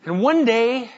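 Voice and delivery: normal talking voice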